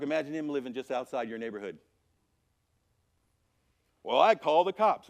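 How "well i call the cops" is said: loud, higher mock-neighbor retort; insistent neighbor. Neighbor answering about the wild man outside, loud and insistent. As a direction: answer back loudly as a confident neighbor.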